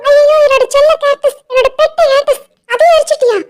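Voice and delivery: high-pitched, anguished disbelief; horrified lament. Acted. Lily learns Subbu burnt her pet cactus, horrified. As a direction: wail in shock over a beloved thing destroyed.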